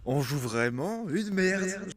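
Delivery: very slow, high, exaggerated complaint; drawn-out mock lament. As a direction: stretch every word in mock despair. Répète en traînant. (lamentation traînante, voix haute)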